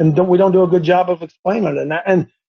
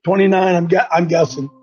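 normal talking voice

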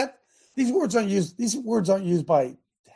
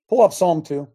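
called out loud, to the producer; 7 dB louder than usual. Real, not acted. Pastor calls out loud to the producer for the passage. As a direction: boom it out to the back.

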